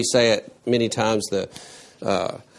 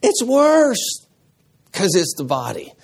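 "worse" high and loud; "It's worse" pitched high and loud; emphatic worse; 9 semitones higher, 3 dB louder than usual. Retold or imitated. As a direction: punch the word "worse" high. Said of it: "He's saying".

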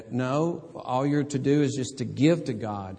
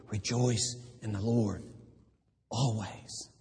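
softer, intimate earnest command; quiet, eye-to-eye; 2 semitones lower, 6 dB quieter than usual. Retold or imitated. Paul holding us by the shoulders, speaking softly. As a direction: lean in close and say it softly. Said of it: "He looks us in the eye and says".

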